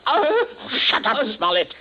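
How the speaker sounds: high, sharp snap; snapping. Acted. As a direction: cut him off sharply. Princey snaps at the captain.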